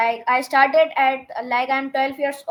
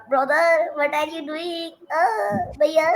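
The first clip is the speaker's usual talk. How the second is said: lightly voiced cartoon boy, a bit higher; Augie dub voice; 3 semitones higher, slower than usual. Shown as a demonstration. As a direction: call out to big brother like a cartoon kid. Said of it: "Like, Augie".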